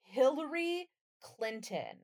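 ordinary delivery

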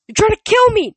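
very high, loud panicked accusation; scream. A narrator's reading. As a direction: scream it in terror and outrage. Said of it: "Nico screamed".

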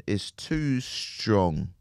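normal talking voice

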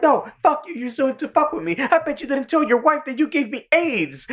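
high-pitched imitated outrage; mock angry rant. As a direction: rant at him like an indignant friend. Acts Kyle's quarrel with Cartman.

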